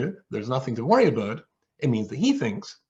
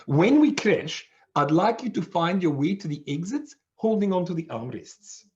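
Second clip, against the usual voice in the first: high-pitched South African flight attendant briefing; South African accent; 6 semitones higher than usual. Retold or imitated. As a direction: give instructions in a put-on South African accent. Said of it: "in her South African accent".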